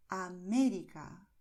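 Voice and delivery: América, slow with a strong stress on MÉ; América pronounced deliberately, second syllable stretched and loud; stressed syllable demo. Shown as a demonstration. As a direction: lean hard on the MÉ in América. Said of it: "la sílaba tónica es la sílaba que destacamos".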